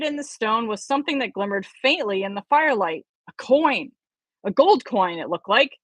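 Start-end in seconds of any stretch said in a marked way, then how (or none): none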